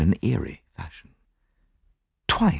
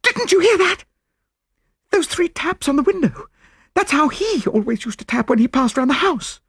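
very high, panicky widow; gasp, then cry out in high-pitched fright; terrified cry. A narrator's reading. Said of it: "caught Poirot's arm with a scream".

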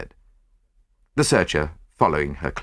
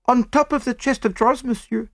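very high female French voice; French maid. A narrator's reading. French maid answers Poirot's question, pitched high.

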